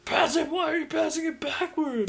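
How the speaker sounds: high-pitched heckling whine; mock exasperated fan. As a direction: imitate a fan scolding the team. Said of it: "so he's like".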